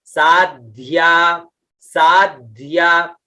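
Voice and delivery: साध्या slowly with both द and ध sounded; careful pronunciation. Shown as a demonstration. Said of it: "द भी निकलना चाहिए और ध भी". (साध्या में द और ध दोनों साफ़)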